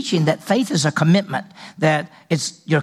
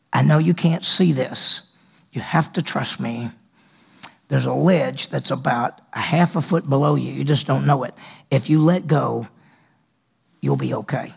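mysterious voice; 4 semitones lower than usual. Acted. Voice tells climber to let go, low and measured. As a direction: reassure from the unseen, low and unhurried. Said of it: "And the voice says".

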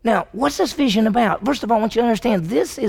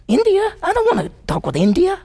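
high-pitched mock-incredulous question; mock disbelief; 11 semitones higher than usual. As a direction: act out a baffled refusal, pitched up. Acts out a dismissive imagined reply.

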